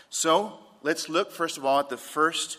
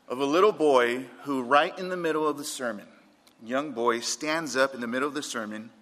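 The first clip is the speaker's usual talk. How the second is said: usual speaking voice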